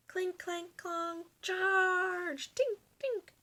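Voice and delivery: high, lively clanging and a charge cry; high battle noises, playful; battle clanking. Acted. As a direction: play out the clash of swords. Battle sound effects.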